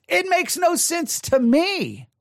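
high, drawn-out exasperation; real bewildered protest, slow and high; exasperated. Real, not acted. Exasperated climax after repeated contradictions.

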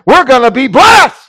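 high, loud revival shout; whooped-up congregation cry; mock shout. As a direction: imitate worshippers shouting in triumph. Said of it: "they get up and they shout".

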